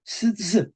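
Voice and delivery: "s, s" as hissed s-t-s clusters; difficult s-t-s cluster. Shown as a demonstration. Teacher shows a hard cluster, "s, s" as sts, sts. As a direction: hiss "s, s" broken by a t. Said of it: "esto es difícil de pronunciar".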